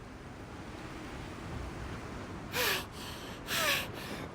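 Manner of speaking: a long unvoiced tired sigh; slow falling exhale of fatigue; long breathy sigh. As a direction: let all the air out, worn out. Weary breath after the chase.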